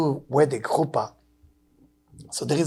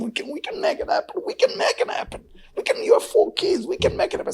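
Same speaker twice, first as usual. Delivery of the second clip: coaxing whisper; 14 semitones higher than usual. Retold or imitated. Friend urging him to remarry.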